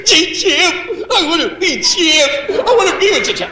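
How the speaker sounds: put-on high, squeaky kid voice; child star voice. Comic mimics young Jackie Cooper pleading with his co-star, high and squeaky. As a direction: pipe it up like an eager little boy. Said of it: "And you went".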